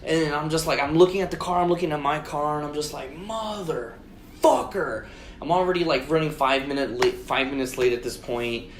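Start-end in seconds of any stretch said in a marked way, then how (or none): 3.2-5.1 drawn-out, two-part retold curse; stunned cursing. Driver stares at both cars, slow exasperated curse. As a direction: split the curse, starting soft and higher.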